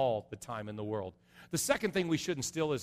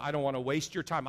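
usual speaking voice

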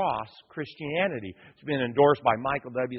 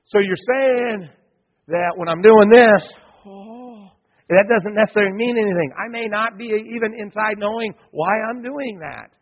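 puzzled disciple; 6 semitones higher, 9 dB louder than usual. Retold or imitated. Pastor voicing a puzzled disciple to his flock, higher and louder. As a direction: voice the disciples just catching on.

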